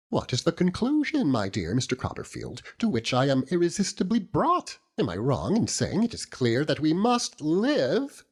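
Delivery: high, earnest matronly lady voice; high-pitched loyal wife holding forth; Mrs Micawber. Acted. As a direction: lift into the proud, insistent wife.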